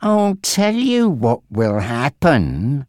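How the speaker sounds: drawn-out, lingering threat; slow menace. A narrator's reading. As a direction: take your time, savouring every word of the threat. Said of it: "Frankie continued slowly".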